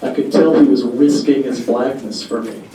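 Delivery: ordinary delivery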